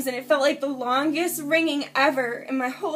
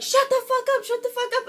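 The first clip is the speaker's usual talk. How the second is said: high-pitched mimicked order, repeated; imitated angry swearing; 10 semitones higher, faster than usual. He swears at her while she screams, imitated anger. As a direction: mimic him high and insistent, not shouted. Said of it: "he's swearing at me".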